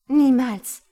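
defiant shout. Acted. Patricia screaming her refusal at the stranger, defiant. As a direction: hurl the refusal at him with full force.

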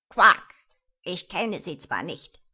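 loud jolly frog, ending in a loud croak; a fat frog teasing a friend. A narrator's reading. Frog voiced in story.

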